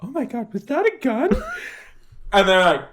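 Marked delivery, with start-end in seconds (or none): 0.0-1.3 high, hushed mock alarm; alarmed neighbors. Neighbors hear a gun sound through the door, mock alarm. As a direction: imitate neighbors startled into hushed voices.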